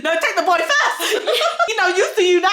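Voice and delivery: laughing joke. Real, not acted. Joking reply to host.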